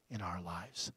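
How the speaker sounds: breathy hushed close of the line; whisper. Acted. Preacher whispering God's promise to the congregation. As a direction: drop it to a whisper.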